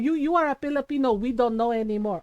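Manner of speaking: imitated Filipino accent, mom telling him off; Filipino mother. Mom cutting Joe off, imitated Filipino-accented rebuke. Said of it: "my mom popped off".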